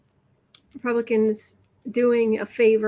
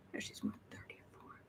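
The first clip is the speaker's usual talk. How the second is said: whispered aside; 25 dB quieter than usual. Real, not acted. Muttered aside while scrolling.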